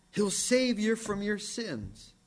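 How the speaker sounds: ordinary talk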